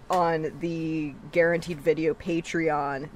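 usual speaking voice

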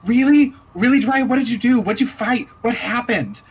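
very high, loud excited questions; little girls begging Jiraiya for the story; eager young girls. Acted. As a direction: pepper her with thrilled kid questions. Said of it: "the younger Red Jills go".

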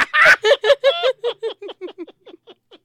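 high burst of loud laughter; real belly laugh; big laugh. Real, not acted. Laughing at own joke.

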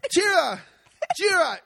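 high, loud repeated commands; yelling orders. Retold or imitated. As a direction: call out each tira like a pushy foreman. Mimics Vittorio yelling orders.

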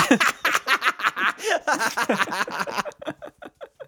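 high-pitched dolphin squeal laugh; chirpy clicking dolphin. Pretend. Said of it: "That was my dolphin impression".

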